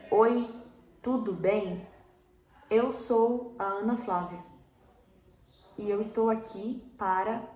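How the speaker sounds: stilted, word-by-word recitation with gaps; read-out delivery. Shown as a demonstration. Teacher showing students a bad monologue, stiffly read out. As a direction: sound like you are reading the script aloud. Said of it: "às vezes vem lido".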